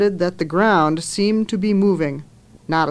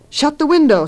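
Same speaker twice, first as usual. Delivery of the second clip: higher, louder sharp command; urgent order; 8 semitones higher, 5 dB louder than usual. A narrator's reading. Mother ordering the girls to shut the window, urgent. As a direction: snap it out urgently.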